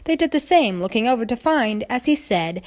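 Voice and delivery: ordinary delivery, nothing marked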